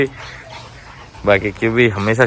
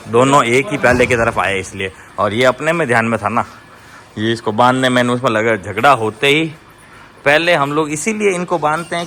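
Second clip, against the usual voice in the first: normal talking voice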